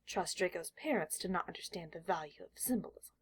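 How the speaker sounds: ordinary delivery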